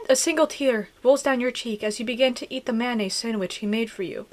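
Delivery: usual speaking voice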